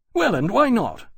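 high, loud challenge; indignant retort thrown straight back; demanding. A narrator's reading. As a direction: demand an answer, affronted. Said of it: "Granby demanded".

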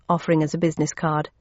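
ordinary delivery, nothing marked

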